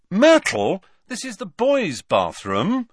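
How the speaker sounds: loud, high indignant outburst; high-pitched shocked objection; startled protest. Acted. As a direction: call her out in startled protest. Startled by ghost in bathroom.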